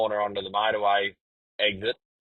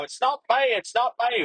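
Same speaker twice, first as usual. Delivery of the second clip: much higher, frantic protest; retold panicked denial; 12 semitones higher than usual. Panicking driver denying it to the trucker, frantic. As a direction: plead desperately into the radio.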